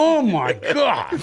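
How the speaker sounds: slow, high, drawn-out oh my God; exasperated groan. Retold or imitated. Son reacting to his mother's notes, slow and exasperated. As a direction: drag it out in disbelief.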